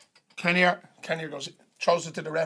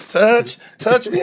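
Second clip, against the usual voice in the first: loud, slow, raised-pitch begging; mock starstruck plea; 7 semitones higher, 11 dB louder, much slower than usual. Starstruck player calling to the singer, loud hammed-up plea. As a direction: call out like an adoring fan.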